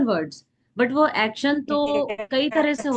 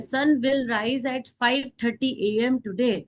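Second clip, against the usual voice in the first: slow dictation; much slower than usual. Shown as a demonstration. Teacher dictates example sentence.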